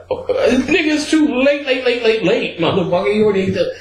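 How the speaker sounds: very high, laughing taunt; laughing mockery. Teasing a friend who ate the cookie, high laughing taunt. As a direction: squeal it through laughter. Mocking him for eating it.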